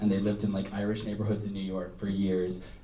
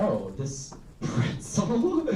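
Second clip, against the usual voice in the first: slow and higher baffled question; baffled wonder; 9 semitones higher, much slower than usual. Retold or imitated. Child self staring at the object, slow baffled question. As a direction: stretch it out, puzzled. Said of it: "I was like".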